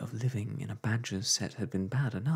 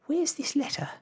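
much higher, breathy and urgent; exclaiming; 4 dB louder than usual. A narrator's reading. Wife asking for the uncle's letter, urgent and high. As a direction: demand it eagerly. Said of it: "exclaimed Homily".

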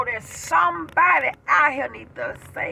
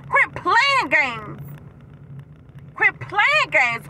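slow preaching exhortation; 5 semitones higher, much slower than usual. Real, not acted.

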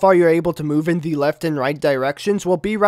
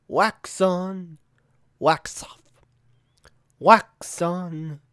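soft sensei; 6 dB quieter, much slower than usual. Retold or imitated. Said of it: "an iconic scene from The Karate Kid".

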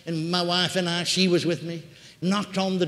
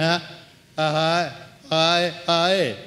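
faint stirring from deep within, as imitated; voice from deep inside; 4 dB louder, much slower than usual. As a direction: answer from down inside without moving the lips. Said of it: "way down inside".

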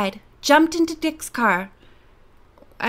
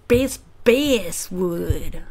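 sounding out; 5 semitones lower, much slower than usual. Real, not acted. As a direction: stretch out "Bass, Basswood", lower. Sounds out the name slowly.

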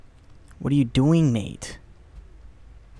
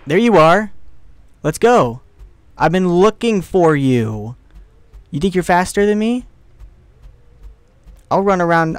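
mock-menacing hunter's call, raised voice; loud taunting; 4 semitones higher, 6 dB louder than usual. Player hunting the hamster, loud taunting. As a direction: call out your prey with loud bravado.

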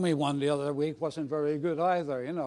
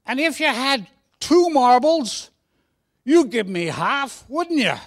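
put-on high, very loud Cockney boy; Cockney accent; 7 semitones higher, 9 dB louder than usual. Cockney boy asking for one of two marbles, loud high Cockney. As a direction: hammer the question home in Cockney. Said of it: "Should I put on a Cockney accent".

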